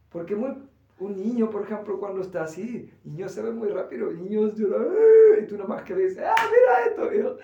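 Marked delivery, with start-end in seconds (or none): 4.9-5.4 very high, loud yelp; hurt child crying out, high and loud; child's cry. Retold or imitated. 6.2-7.1 very high, loud, bright coaxing; adult distracting a crying child, pitched way up.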